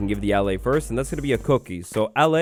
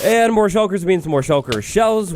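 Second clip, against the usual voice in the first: 1 emphatic repeat; 6 semitones higher, 4 dB louder, slower than usual. Shown as a demonstration.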